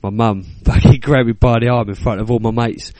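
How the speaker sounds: normal talking voice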